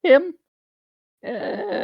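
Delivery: squeaky dismissive voice; high mock disdain. Impression continues, disdainful.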